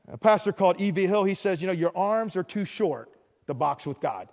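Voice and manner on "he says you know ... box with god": normal talking voice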